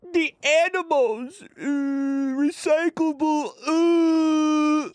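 high whiny mock voice of his wife, slow; shrill mocking impression of a preachy wife. Mocking wife's eco nagging.

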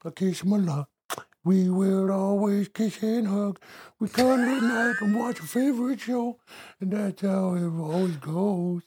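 gushing pet talk. Real, not acted. Man gushes about cuddling his pet, high and soft. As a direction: dote on him with a high, soft voice.

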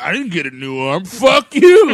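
hammed-up bitter "Fuck you"; mock bitter drunk. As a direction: spit it out like a resentful barfly. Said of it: "he's, like, bitter about it".

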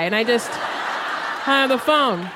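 normal talking voice

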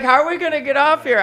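mock panic pitched well up, loud; panicked question. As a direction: imitate her scared plea. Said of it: "She's like".

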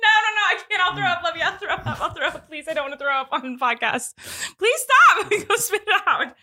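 pleading through laughter; laughing. Real, not acted. Laughing, nauseated, begging him stop.

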